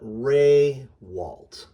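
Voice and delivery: Raywalt slow and loud, "RAAY ... walt"; very deliberate "RAY-walt" for Raywalt; correct surname. Shown as a demonstration. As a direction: stretch and stress RAY, then a lighter walt. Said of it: "is how it is pronounced".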